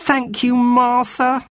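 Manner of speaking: put-on high, slow, grateful girl's cry; a girl thrilled at the jug; cried out thanks. A narrator's reading. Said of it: "cried Anthea".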